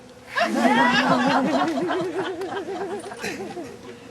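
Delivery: long high cackling laugh; long loud laugh. Real, not acted. Comic cracking up at his own virgin joke, long loud laugh.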